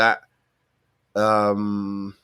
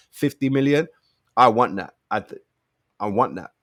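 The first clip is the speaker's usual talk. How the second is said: ordinary talk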